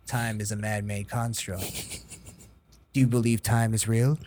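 lightly voiced cryptic sage; mock mystical. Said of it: "like, you'd go".

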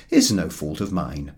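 normal talking voice

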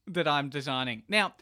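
ordinary talk

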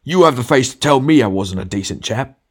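high, loud affronted outburst; indignant. A narrator's reading. Ghost told he wasn't decent, indignant. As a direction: challenge the insult with wounded pride.

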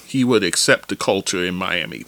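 ordinary delivery

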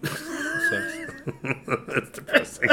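laughing. Real, not acted. Joking about being broke.

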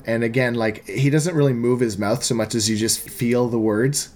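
ordinary talk

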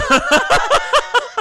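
shrieking laugh, way up high; high squealing laugh. Real, not acted. Laughing at own joke.

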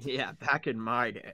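slow laughing old-timer impression; old man voice.

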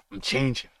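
half-voiced panicked realisation; a man feeling himself change; man transforming. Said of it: "I got a little intro for you guys".

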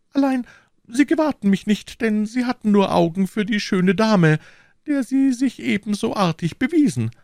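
very high falsetto lady, reproachful; offended procurator's wife. Acted. (gekränkte Frau in hoher Fistelstimme)

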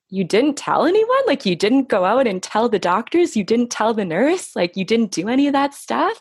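high-pitched, disbelieving questions; imitated incredulity. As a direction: quote them as astonished at every question. Voicing people's incredulous reactions.

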